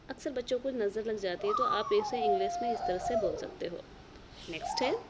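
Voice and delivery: ordinary delivery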